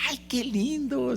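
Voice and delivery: high, slow mock old lady. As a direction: swoon it like a charmed old woman. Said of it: "le dijo". (una viejita encantada, aguda)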